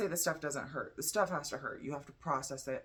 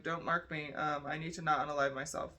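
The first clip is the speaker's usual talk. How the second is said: ordinary talk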